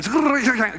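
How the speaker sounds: high comic whirring squeal; playful sound effect of scrambling over; flying squirrel noise. Retold or imitated. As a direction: imitate a nimble leap with a high noise. Imitating man flying over the wall.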